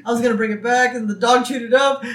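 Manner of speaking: high, loud mock panic; panicked. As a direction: blurt excuses in a put-on frantic high voice. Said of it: "Marge starts to panic".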